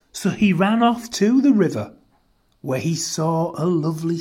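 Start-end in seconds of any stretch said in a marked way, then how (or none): none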